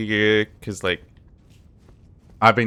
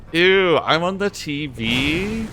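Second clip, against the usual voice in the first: mock surprise; 9 semitones higher, slower than usual. Reacting to chat message.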